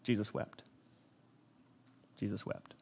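very soft, low delivery; hushed solemnity. Real, not acted. Preacher quoting the shortest verse in Scripture, hushed and solemn. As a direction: let the verse drop almost to nothing.

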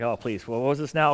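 an imitated sigh of grammar confusion; mock confused groan. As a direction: play the bored student lost in grammar. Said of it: "some of you are like".

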